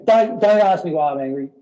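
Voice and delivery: hammed-up irritated refusal; mock angry snap. Acting out refusing the question.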